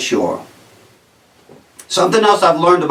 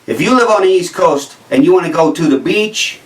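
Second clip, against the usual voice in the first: ordinary delivery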